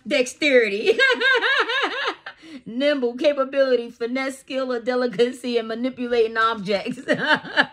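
loud, high, laughing through the words; giggling loudly through a definition; laughing while reading. Real, not acted. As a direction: read it while cracking up.

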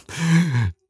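a high giggle that falls away; can't hold back a giggle; giggling laugh. Acted. Giant trying to scold the tiny eater, high giggle. Human eats messily, giant amused.

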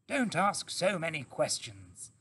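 lightly voiced brisk Mister Fox. A narrator's reading.

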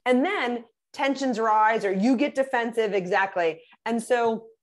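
ordinary talk, nothing marked

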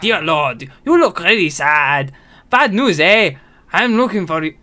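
put-on character read in a Spanish accent; hammed-up Spanish accent; mock Spanish accent. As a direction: put on a swinging Spanish lilt. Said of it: "does that mean the guy is Spanish?".

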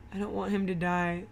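usual speaking voice

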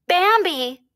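high, urgent calls; mother searching, calling high. A narrator's reading. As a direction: call out for a lost child. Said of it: "his mother called".

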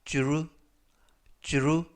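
"주루" as a j-like dr blend; DR sound isolated. Shown as a demonstration. Teacher pointing out the DR sound, 주루 said separately. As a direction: demonstrate the dr blend as "주루". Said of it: "디알 발음이".

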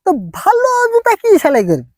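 high, quick protest; high-pitched defensive comeback; indignant excuse. Acted. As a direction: squeal your way out of a boast. Wriggling out of his boast.

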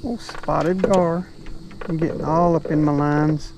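usual speaking voice

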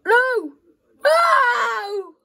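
frustrated shout. Real, not acted. Gamer shouting at a missed chance, loud. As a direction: scream at the miss.

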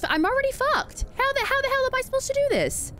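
high, fast panicky plea; high frantic question; panicked. Real, not acted. Panicking about the monster's roar.

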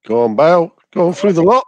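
loud excited cheering. Real, not acted. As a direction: roar him through the defence. Cheering on dribble.

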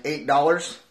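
ordinary talk

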